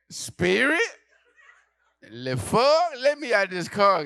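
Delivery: high, loud disbelief; hammed-up horror; shocked outrage. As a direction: demand to get out. Horrified at airline choice.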